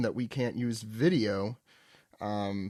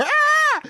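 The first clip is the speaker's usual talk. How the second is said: high mock scream; 8 dB louder than usual. Kid screams at monster.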